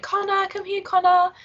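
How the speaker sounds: Australian call to the dog, R dropped; calling Connor, Australian. Retold or imitated. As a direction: shout Connor the Aussie way, no final r. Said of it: "whenever we were saying".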